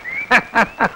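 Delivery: soft staccato chuckle; scheming laugh. Acted. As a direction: laugh quietly over your crafty plan. Plots to grab the property.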